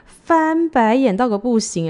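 laughing while rolling her eyes; slow, laughing disbelief. Real, not acted. Laughing at the absurd messages.

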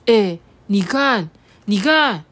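loud, excited pointing out; amazed, loud and eager; surprised. Acted. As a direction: call attention in surprise. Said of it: "韩吉就很惊讶啊".

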